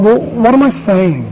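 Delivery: normal talking voice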